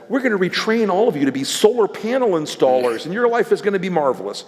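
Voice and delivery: mocking sing-song. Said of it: "Democrats said".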